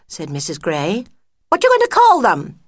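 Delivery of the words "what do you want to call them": high, loud bright friend voice; a shrill-pitched busybody mouse cooing; fussy friend mouse. Acted. Friend cooing over the new cubs, high and loud.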